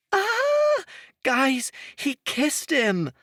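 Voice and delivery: high, squealing excitement; excited scream. A narrator's reading. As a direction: shriek with delight. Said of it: "She nearly screamed".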